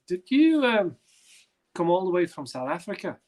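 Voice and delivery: high, halting disbelief as the stranger; retold incredulity. Stranger questions a travelling fan, retold incredulity. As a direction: play up the stranger's astonished question.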